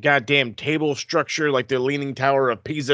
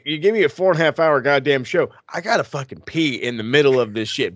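usual speaking voice

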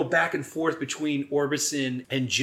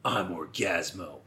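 low, soft superhero voice; mock-solemn hero reveal; heroic declaration; 8 semitones lower, 8 dB quieter than usual. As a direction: announce your hero name in a low voice. Acts hero declaring his identity.

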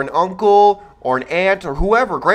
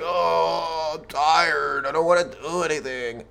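lazy whine; 6 dB quieter, slower than usual. Retold or imitated. Lazy son voiced by host.

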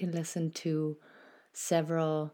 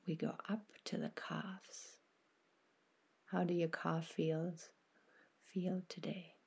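guided meditation; 4 dB quieter than usual. Acted. Host guiding listeners through a body scan, soft meditation voice. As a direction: ease the listener into stillness, unhurried and gentle.

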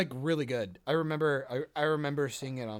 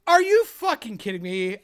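outraged outburst; 6 semitones higher, 9 dB louder than usual. Real, not acted. Heated argument over actor residuals.

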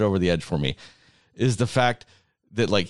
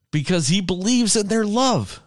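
worked-up rant, much higher pitch; angry; 9 semitones higher than usual. Real, not acted. Fan defending a firefly's love to friends, angry and worked up. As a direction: argue it passionately, voice climbing high. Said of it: "Just so angry still".

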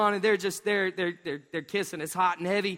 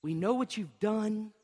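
slow soft pleading; 2 semitones lower, 5 dB quieter, slower than usual. Retold or imitated. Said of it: "they say".

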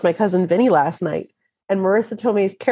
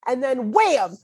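high-pitched, hammed-up Brooklyn accent rant; Marissa Tomei Brooklyn accent; 8 semitones higher than usual. Acting out the deer getting shot, indignant Brooklyn accent. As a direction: punch it like an indignant Brooklyn movie character. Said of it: "pretending like I'm Marissa Tomei".

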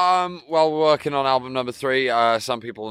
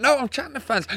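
self-imitation; 13 semitones higher, faster than usual. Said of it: "he's usually".

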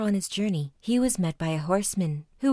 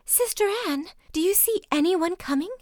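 crying out; 11 semitones higher than usual. A narrator's reading. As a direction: call up the tower in panic. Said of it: "she once more cried out".

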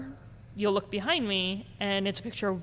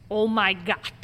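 louder imitation of a robotic ghost; lightly robotic, guttural delivery; creepy guttural robot; 5 dB louder than usual. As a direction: drop it out like a creepy recorded voice. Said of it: "the robotic's creepy guttural voice".

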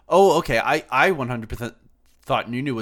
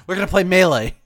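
excited; 8 semitones higher, 10 dB louder than usual. Real, not acted. Joking announcement with laughter.

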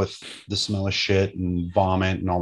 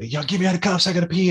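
high pleading voice of a detainee; whiny prisoner; 9 semitones higher, faster than usual. Retold or imitated. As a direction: beg to pee in a high voice.

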